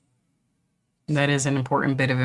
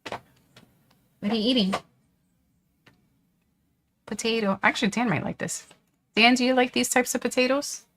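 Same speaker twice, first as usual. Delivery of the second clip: pet talk voice; 9 semitones higher than usual. Acted. Talking to the dog.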